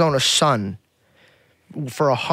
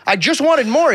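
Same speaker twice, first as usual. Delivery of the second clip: put-on indignant teen; 7 semitones higher than usual. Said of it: "And I'm like".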